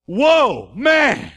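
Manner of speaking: loud, high, amazed Whoa man; Adam stunned at first sight of woman, loud and high. Retold or imitated. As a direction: gasp it out like seeing a wonder. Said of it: "Adam looks at her and goes".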